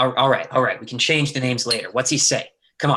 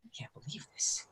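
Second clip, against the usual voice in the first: hushed, breathless disbelief; whispered disbelief; 4 semitones higher, 18 dB quieter than usual. Acted. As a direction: whisper it to yourself. Lee exasperated aside.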